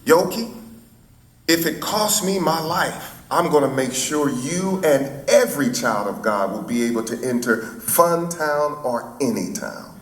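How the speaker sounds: impassioned vow in an orator's cadence, starting high; Martin Luther King. Retold or imitated. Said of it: "So I said".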